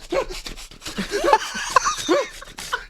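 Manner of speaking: a helpless shrieking laugh; high squealing laugh. Real, not acted. Laughing at own story.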